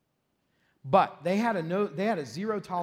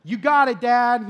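louder, higher relieved little victory; relieved cheer; 5 semitones higher, 11 dB louder, slower than usual. Retold or imitated. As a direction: celebrate getting off the hook. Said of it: "I was like".

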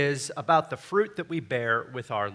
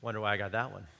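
low, soft musing aside; inner grumble; 7 semitones lower, 11 dB quieter, much faster than usual. Retold or imitated. Preacher voicing his doubts about an assigned topic, musing quietly. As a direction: think it aloud, much softer. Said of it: "in the back of my mind".

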